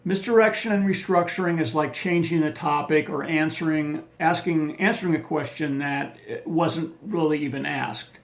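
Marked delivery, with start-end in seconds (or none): none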